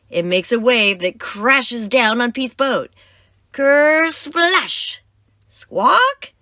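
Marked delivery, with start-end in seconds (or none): 3.5-6.3 very high, loud parrot squawk. Acted. The parrot crying out as the wave hits, screeching high. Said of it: "cries the parrot".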